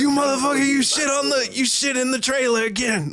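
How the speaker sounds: high, strained mock yell at the dog; mock furious. Neighbor cursing his dog for messing the trailer, mock furious yell. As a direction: chew out the dog like it ruined the trailer.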